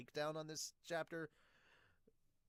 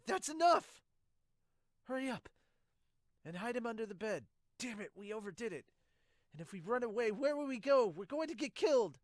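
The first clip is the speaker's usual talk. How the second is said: much higher and louder frantic calls; panicked shouting; 6 semitones higher, 6 dB louder than usual. Acted. Falco and the family calling out frantically over Gabi.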